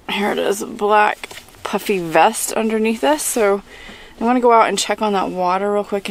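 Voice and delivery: ordinary delivery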